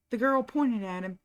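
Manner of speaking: ordinary delivery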